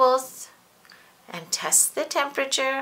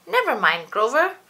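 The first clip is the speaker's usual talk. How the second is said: higher, lightly voiced gentle teacher; kind teacher; 6 semitones higher, 4 dB louder than usual. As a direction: comfort a child like a teacher. Grover quotes teacher.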